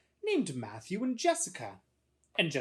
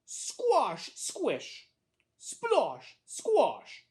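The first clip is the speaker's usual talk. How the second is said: high onset falling on each word; squelching sound effects; 11 semitones higher, 5 dB louder, much slower than usual. A narrator's reading. Boy walking home through mud, voiced squelchy footsteps. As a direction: punch out each mud-step word separately.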